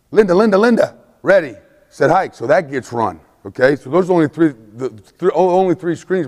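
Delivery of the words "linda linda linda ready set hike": high repeated call of Linda. Retold or imitated. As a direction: sing it out down the line. Quarterback calling the route.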